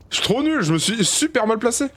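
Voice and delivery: high-pitched frustrated outburst; high, real gamer despair; exasperated self-reproach. Real, not acted. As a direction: wail at how badly you played. Gamer berating own misplay.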